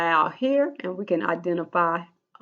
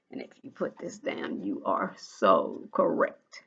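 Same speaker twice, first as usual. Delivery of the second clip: hushed praise; 12 semitones lower, 8 dB quieter than usual. Real, not acted. Teacher to students who got it right, whispered praise. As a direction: breathe the praise like a secret. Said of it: "teacher praising in a hush".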